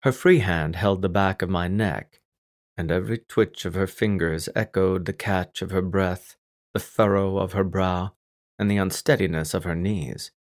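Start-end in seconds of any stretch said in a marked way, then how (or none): none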